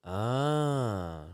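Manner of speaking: ordinary talk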